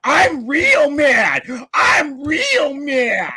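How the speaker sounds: slow, high, whiny mock of being mad; mocking a rival's tantrum, slow and high. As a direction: drag it out to mock his anger. Mocking the angry opponent.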